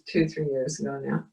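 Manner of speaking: usual speaking voice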